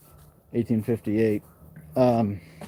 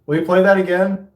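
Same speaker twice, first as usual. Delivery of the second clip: loud, higher voice calling out; 7 semitones higher, 5 dB louder than usual. Real, not acted. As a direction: call out to an unseen presence. Calling out to spirits.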